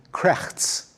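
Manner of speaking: KRECHTS with a throaty, rasped ch; Yiddish-style "krechts", the ch scraped as in "Bach"; guttural Yiddish word. Shown as a demonstration. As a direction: say "krechts" with a back-of-throat ch, then ts. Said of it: "sounds like ch".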